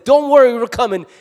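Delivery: high, loud call that help is on the way; a big heroic reply: don't worry, we're coming; rallying shout. Acted. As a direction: shout it like the cavalry answering. Friends answering a distress call.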